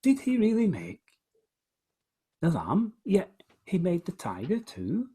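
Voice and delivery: voicing the poet's amazed question; asking in wonder. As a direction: ask it high, with pauses to let it sink in. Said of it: "He's, he's asking".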